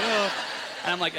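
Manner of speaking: ordinary talk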